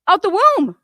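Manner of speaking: real contempt, repeated for effect; sarcastic. Real, not acted. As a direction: spit the phrase back with scorn. Sarcastic echo of own phrase.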